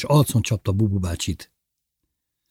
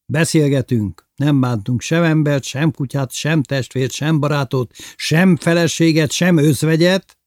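lifted, lively cheerful listing; 7 semitones higher, 5 dB louder than usual. A narrator's reading. Lili rallies the drinking company, cheerful and high. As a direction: rattle off the list cheerfully, pitched up. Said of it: "mondta derűsen Lili".

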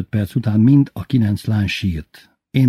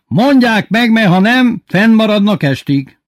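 high, loud ultimatum; shouted threat; 12 semitones higher, 6 dB louder than usual. A narrator's reading. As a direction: threaten the whole class, yelling. Headmaster threatening after yelling. (fenyegető kiabálás)

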